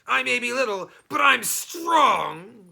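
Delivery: high, loud goblin threat, slowing on strong; threatening goblin. Acted. Little goblin threatening Herschel, high and loud, dragged out. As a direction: puff up and threaten. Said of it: "threatened the little goblin".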